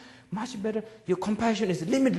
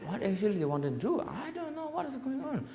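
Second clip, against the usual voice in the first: put-on bewildered child; 5 semitones higher, 8 dB quieter than usual. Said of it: "and I said".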